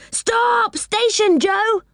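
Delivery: very high, urgent call; crying out. A narrator's reading. Rick spots a station and warns Joe, crying out. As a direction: call out a high-pitched warning. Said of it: "cried Rick".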